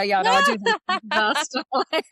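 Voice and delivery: playful, cocky mock boast with a grin; cheeky jest. Telling a friend about a compliment, a cheeky mock-proud boast. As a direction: toss it back like a cheeky joke. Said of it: "done in a bit of jest and fun".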